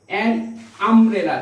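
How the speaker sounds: ordinary delivery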